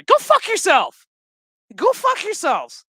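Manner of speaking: high, loud furious yell; real furious outburst; angry shout. Real, not acted. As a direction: blast the writers. Ranting at the show's writers.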